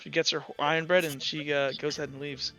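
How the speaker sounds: ordinary talk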